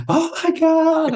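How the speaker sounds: high, slow, stretched-out shocked cry; shocked gasp. Retold or imitated. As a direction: draw out the horror like a frightened wife. Wife hears he was hit.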